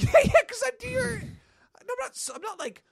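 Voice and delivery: very high, stammering protest; retold flustered retort. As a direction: squeak out a worked-up comeback. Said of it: "I'm like".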